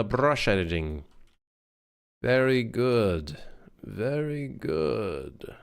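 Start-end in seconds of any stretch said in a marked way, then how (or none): 3.8-5.5 slow and softer, a different voice. Shown as a demonstration. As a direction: drag out the praise softly. Repeating praise in different voices.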